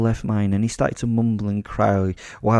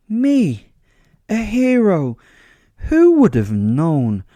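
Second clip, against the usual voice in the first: high, loud theatrical disbelief; hammed-up wonder, each phrase stretched and set apart; mock amazement; 11 semitones higher, 8 dB louder, much slower than usual. Self-styled hero monologuing at his attackers, mock amazement. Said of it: "pointing at myself".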